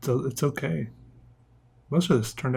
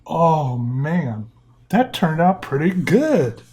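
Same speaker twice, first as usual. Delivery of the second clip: excited; 4 semitones higher, 4 dB louder, much slower than usual. Real, not acted. Reveal of glowing drawing.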